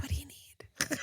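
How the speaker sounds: hushed, voiceless whisper; soft, breath-only question; whispered plea. Real, not acted. Pleads as host holds out for more.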